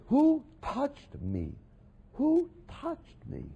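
slow, searching, rising high; Jesus asking. Acted. Preacher voicing Jesus asking who touched him, slow and searching. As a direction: draw out the question, high and slow.

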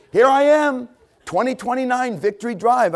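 high, loud call to be spotted. Acted. Preacher calling his address to God, high eager shout. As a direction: shout up to get noticed. Jumps up, calls his location.

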